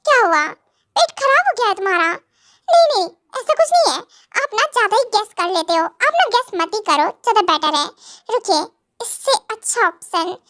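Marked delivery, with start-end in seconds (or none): 2.7-4.0 flustered denial. Real, not acted. Wife denies quickly, flustered.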